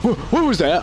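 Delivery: high, sudden hey; mock startled manager. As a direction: manager catching the cashier marking a bill, high and startled. Manager noticing marked bill.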